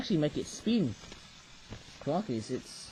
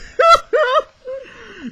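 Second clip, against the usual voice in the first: loud, high hammed-up bawl; exaggerated crying outburst; mock wailing; 13 dB louder than usual. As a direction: wail at full volume in pretend grief. Mock grief at last toy.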